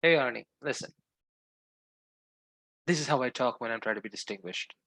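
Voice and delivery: low, measured distinguished voice. Pretend. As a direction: sound distinguished on the phone. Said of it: "Do, do a distinguished interpretation".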